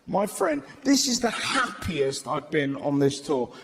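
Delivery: ordinary delivery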